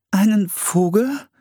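slow, high, rising echo; puzzled question. A narrator's reading. Young woman echoing an odd idiom, puzzled. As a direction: ask it high, not understanding. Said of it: "fragte Priscilla".

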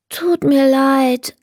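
lightly voiced apologetic child. A narrator's reading. Tired Leni turning down the kobold, drawn-out apology.

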